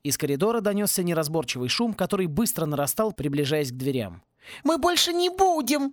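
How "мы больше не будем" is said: much higher squeaky plea; squeaking. A narrator's reading. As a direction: squeal like a scared boy. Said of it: "пищал кто-то". (пискляво, на высоком голосе)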